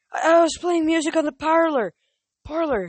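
high, loud, stammering satyr; panicked stammer. Acted. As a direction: stumble over the words in panic. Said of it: "he stammered".

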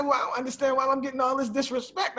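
lightly acted puzzled indignation; mock offense. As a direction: play the innocent, slightly wounded. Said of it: "I said".

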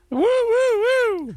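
high crowd whoops; cheering whoops. Pretend.

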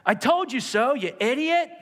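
high, loud mocking rebuke; mock scolding. Jesus not doing this to Peter, mock loud high scolding. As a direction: jeer at the one who fell. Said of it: "and go".

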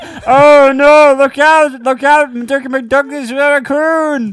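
high, loud, slow hammed-up alarm; very loud, high mock panic; cartoon panic. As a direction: shriek a warning like a cartoon soldier. Cartoon skit, panicked warning.

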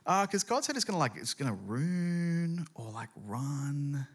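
slow, stretched-out uncertain sounding-out; mock-baffled husband; groping for a word. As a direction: draw out each attempt at the strange word. Noah gropes for an unknown word.

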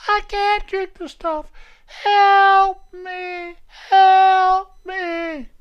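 hammed-up squeal of a desperate patient; comic high-pitched plea for rescue; mock panicked patient. Acted quarrel, nurse versus patient.

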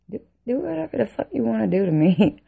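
high-pitched, exasperated surrender; acted-out exasperated dare; fed-up defiance. Retold exhausted defiance to police.

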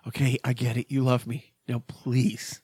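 low, subdued plea to be left alone; imitating a worn-down parent, holding back; weary dismissal. Dad to his over-affectionate son, wearily brushing off.

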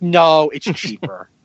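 slow, flat put-down; blunt retort. Retold or imitated. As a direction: say it plainly, no excuses.

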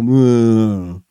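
low, deflated meh; bored meh. Retold or imitated. Retiree shrugging off retirement, deflated meh. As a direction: sound utterly unbothered. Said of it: "come to me and go".

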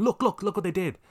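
high, whiny mock outrage, pointing and tattling; mocking tattletale. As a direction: mimic a smug complainer pointing and shouting look.

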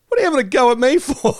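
high, fast mock outrage; hammed-up whiny indignation; indignant protest. [name] hit by teasing, fires back in high mock outrage.

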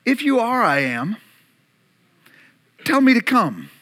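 sarcastic doubt. Acted. Peter doubting Jesus on the water, sarcastic. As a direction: dare him to prove it, sceptically. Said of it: "with sarcasm in his voice".

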